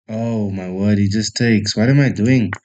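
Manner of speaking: ordinary talk, nothing marked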